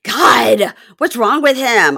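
hammed-up tut-tutting grandma; mocking grandma. Grandma shaking her head at Kobe, a loud mock scold. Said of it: "shaking her head like".

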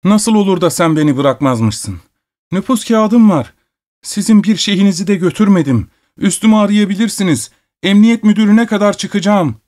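tearful shouting. A narrator's reading. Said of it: "ağlamaklı bir sesle bağırıyordu".

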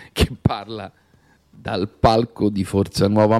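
ordinary talk

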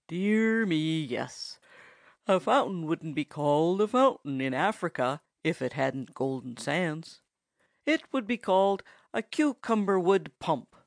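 drowsy woodchuck character voice. A narrator's reading. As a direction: drag the words out sleepily. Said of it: "suggested the woodchuck drowsily".

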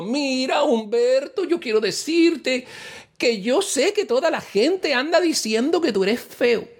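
put-on high, slow, tender girlfriend; sweet girlfriend voice. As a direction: coo it like a loving girlfriend. (novia dulce con voz aguda y lenta)

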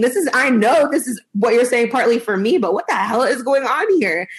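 put-on high, incredulous rant as a fed-up teen; exasperated teenage outburst. Teen at parents' toxic relationship, exasperated high rant. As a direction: voice a teenager who can't believe the adults. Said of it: "sitting there as a teenager, like".

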